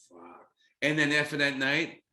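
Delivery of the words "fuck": nearly silent, breathed-out awe; awed hushed curse. Retold or imitated. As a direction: let it slip under your breath, stunned. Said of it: "I was like".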